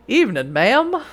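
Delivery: lightly put-on Western accent; a hint of cowboy Western accent. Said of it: "gave him a Western accent".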